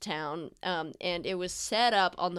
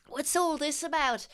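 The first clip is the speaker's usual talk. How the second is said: higher, sharp question from the wife; startled wife; 8 semitones higher than usual. Acted. Wife demanding what stopped the horses, sharp and higher. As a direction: snap the reins and demand an answer. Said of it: "being like".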